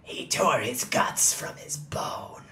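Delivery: unvoiced spooky whisper. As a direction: breathe it like a horror tale.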